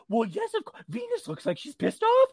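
high-pitched, playful gossipy certainty; squeaky-high mock indignation; mock conviction. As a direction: act out being completely sold on the drama. Acts out her convinced viewer self.

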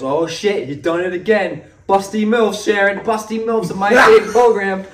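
high-pitched mock indignation; mock outraged dad. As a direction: act out a scandalized dad, half laughing. Acting out dad's outraged discovery.

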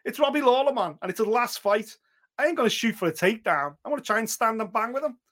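lightly voiced excited fighter; fighter's pumped quote. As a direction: voice a hyped young fighter. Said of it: "He was like".

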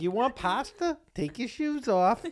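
high southern mom voice; sing-song southern hostess; southern accent. Pretend. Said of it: "a southern accent".

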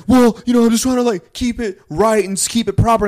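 mock her for being so proper; mocking prissy voice. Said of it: "she would try to say".

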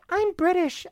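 a lightly voiced teasing Betsy; mock Betsy. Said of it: "I'm not gonna do the accent".

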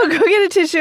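quick, laughing tease; laughing while comforting her friend. Real, not acted. To crying Amanda, laughing fast and teasing.

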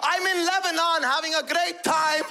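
high-pitched immigrant dad's venting tirade; exasperated father. Retold or imitated. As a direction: vent a lifetime of grievances, pitched high. Father's acted outburst about his feelings.

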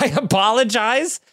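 normal talking voice

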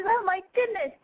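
ordinary talk, nothing marked

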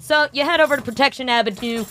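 upbeat sponsor pitch; ad read. Acted. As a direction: sell the wristlets cheerfully.

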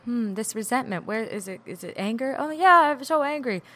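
ordinary talk, nothing marked

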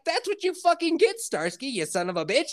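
high, mock yell at the dog; acted angry scolding. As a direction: play an owner telling off the dog.

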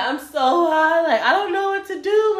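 high, loud mock wail; dramatized helpless whine; retold high panic. Acts out her overwhelmed panic.

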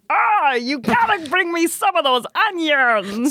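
high, slow, drawn-out puppet voice; neighbor impression. German neighbor walks in asking for onions, high puppet-like holler. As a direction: holler it like an excitable felt puppet. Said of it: "this is how he sounds".

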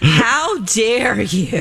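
slow theatrical rebuke; mock indignation. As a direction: draw it out in staged outrage. Theatrical rebuke ending her rant.

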